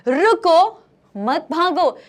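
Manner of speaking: high, loud "रुको", break, then "मत भागो"; pause after रुको. Shown as a demonstration. Teacher explaining the comma, pause after "रुको". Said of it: "इसका मतलब रुको, कॉमा, मत भागो".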